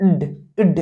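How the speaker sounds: ইড cut to a brief stopped "d", no "aw"; short half-d ending. Shown as a demonstration. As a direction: demonstrate ইড as a quick half ড. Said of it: "ইডির উচ্চারণটা ঠিক". (ইড, অর্ধেক ড-এর ছোট উচ্চারণ)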